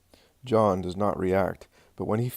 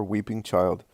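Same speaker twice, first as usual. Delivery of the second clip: usual speaking voice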